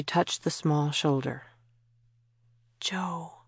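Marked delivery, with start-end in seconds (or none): 2.8-3.4 soft, gentle call; softly. A narrator's reading. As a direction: say her name softly. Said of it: "she said softly".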